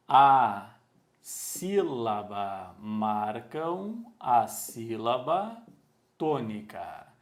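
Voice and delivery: slow, stretched words with pauses; slow dictation. Real, not acted. Teacher writing the accents' function for pupils, slow dictation. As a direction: say it as you write it out. Writing on board while saying it.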